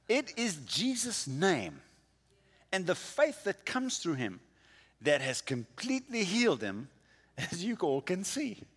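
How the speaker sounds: ordinary talk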